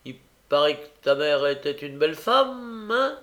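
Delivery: lancer « bâtard » fort et lentement, plus aigu; insulte méprisante. A narrator's reading. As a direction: cracher l'injure à l'orphelin. Noé insulte l'orphelin. (gamin méchant qui insulte, fort et lent)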